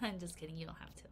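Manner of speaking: soft giggled whisper; playful whispered aside; laughing whisper. Real, not acted. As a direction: whisper the joke away through a laugh. Teasing aside.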